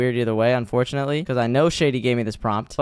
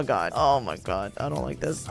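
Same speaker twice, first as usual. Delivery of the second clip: quiet, uneasy aside; soft, dreading murmur; uneasy mutter; 2 semitones lower, 6 dB quieter than usual. Acted. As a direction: say it small and nervous, under the breath. Scared player, whispers closing in.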